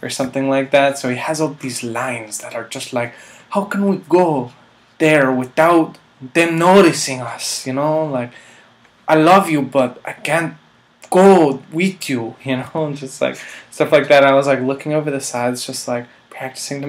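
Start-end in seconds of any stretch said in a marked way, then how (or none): 3.5-7.7 higher, slow melodramatic leading man; hammed-up Latin lover. Acting out the Latin lover lines. 9.1-12.3 louder, higher, slow tragic romantic lead; hammed-up Latin lover. Acting out the Latin lover lines.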